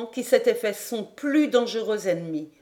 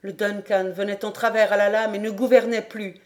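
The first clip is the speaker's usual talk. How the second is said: plain reading voice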